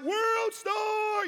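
high, drawn-up hollered cheer; bystander's hype call. Retold or imitated. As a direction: call it out like egging on a fight. Excited bystander cry at a fight.

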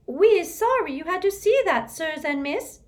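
high, loud elf voice; an ashamed little elf apologizing; squeaky elf. Acted. Elf apologizing to the visitors, squeaky. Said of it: "squeaked a nearby elf".

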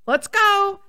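high, punchy call to a fight; triggered battle cry. Retold or imitated. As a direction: launch into a fired-up outburst. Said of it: "that was the trigger. It was like".

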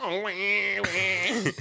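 high-pitched fake bawling; mock crying. Just sitting there mocking a raging driver, fake crying. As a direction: taunt with a crybaby wail. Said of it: "I made crying faces at him".

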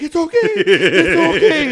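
very high, slow, loud laughing coo; Stewie soothing. Retold or imitated. Stewie calming his teddy bear, cooing high through laughs. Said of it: "he's like".

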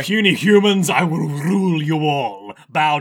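booming villain gloat; loud, grand conqueror voice; giant alien booming voice. Retold or imitated. Dio gloating villain monologue.

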